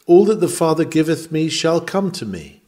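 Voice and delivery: usual speaking voice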